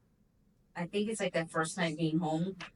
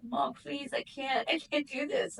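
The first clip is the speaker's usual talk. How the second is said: pleading with mom; 7 semitones higher, slower than usual. Retold or imitated. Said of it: "I'm like".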